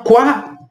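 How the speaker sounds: quá said as "kwa", no final T; quá without T. Shown as a demonstration. Teacher showing the French oi sound as kwa. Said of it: "Tá vendo como eu tô pronunciando?".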